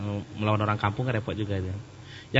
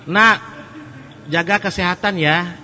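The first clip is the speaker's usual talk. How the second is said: mother's advice; 7 semitones higher, 6 dB louder, slower than usual. Retold or imitated. Mother advises her child.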